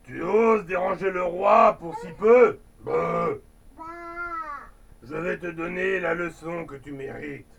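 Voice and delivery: lion scolds Limay for bothering the king, growling. Acted. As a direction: bellow at the girl like an offended lion guard. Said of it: "Le lion gronda".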